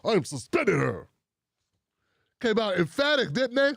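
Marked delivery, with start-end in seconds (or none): 0.0-1.1 lower, mock stern declaration; stern announcement. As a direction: announce it like a strict boss. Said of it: "Look how they came out all stern".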